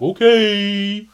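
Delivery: lightly mimicking a whiny voice; mock his voice back at him; imitating his voice. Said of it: "did the voice back at me".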